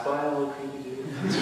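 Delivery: hushed little farewell; quiet farewell. Acted. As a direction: murmur a small goodbye under your breath. Boy's farewell while riding past.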